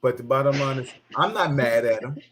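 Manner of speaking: ordinary talk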